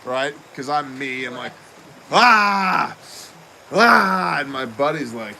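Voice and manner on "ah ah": loud, high retold cries of pain; pained yells. Retelling appendix pain to friends, loud pained yells. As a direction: yell it out like the gut is burning.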